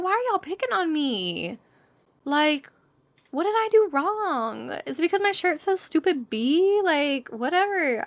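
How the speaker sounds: high-pitched mock aggrieved whine; hammed-up indignation, pitched high; whiny protest. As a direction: complain like you're being picked on. Acts out complaint at being singled out.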